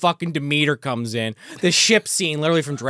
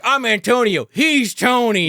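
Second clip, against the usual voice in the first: high, loud hammed-up introduction; imitated mob henchman; 6 semitones higher, 4 dB louder, slower than usual. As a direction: announce it like a comic mob sidekick. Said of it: "henchman, he's like".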